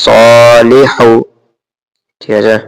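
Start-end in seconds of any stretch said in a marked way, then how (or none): 0.0-1.1 সলিহু slowly stretched, the স held long; slow teaching recitation; drawn-out recitation. Shown as a demonstration. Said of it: "সদ খারা জবর স হবে".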